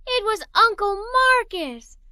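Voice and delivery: thrilled to realise who it is; joyful exclamation; excited. A narrator's reading. Excited discovery.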